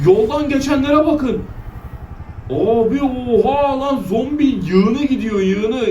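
stunned by a zombie horde; astonished. Real, not acted. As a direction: gape at the huge horde.

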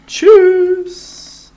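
squeaky high cheerful bye; high farewell. Real, not acted. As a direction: chirp a bright goodbye. Cheerful sign-off to viewers. (hohes fröhliches Tschüss)

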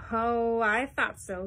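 loud, catching them out; triumphant cry. A narrator's reading. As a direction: call out as if you knew all along. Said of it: "he cried".

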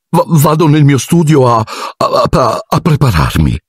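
high, halting excuse; nervous stammer. A narrator's reading. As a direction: trip over the words while retreating. Lockhart spaventato balbetta una scusa. (balbettando impaurito, con voce acuta)

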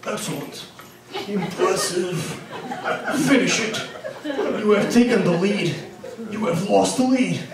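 deep game announcer robot voice; flat robotic game voiceover; low robotic voice. Retold or imitated. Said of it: "in a really low robotic voice".

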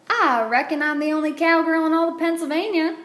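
proud cowgirl. A narrator's reading. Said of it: "said Alice".

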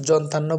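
জন্তান্ন run together as jon-tan-no; common mispronunciation. Shown as a demonstration. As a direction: say জন্তান্ন with an initial j. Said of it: "কিন্তু সঠিক উচ্চারণটা হচ্ছে".

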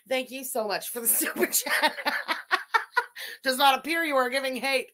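laughing through the thanks. Real, not acted. Host thanking a donor while laughing. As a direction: giggle while reading it out.

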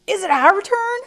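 loud, high, eager question; impatient demand. Acted. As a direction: call out impatiently for a turn. Impatient buffalo herd asks.

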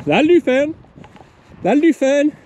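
loud, high dog call. Real, not acted. Farmer to pup out in the field, loud high call. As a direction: holler the command high across a field.

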